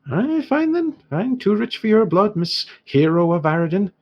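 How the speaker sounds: high, offended Irish dwarf; mock-wounded trader sniping at a customer; huffy dwarf shopkeeper. Dwarf scorns hero who won't buy, huffy and high. Dwarf huffs at refused sale.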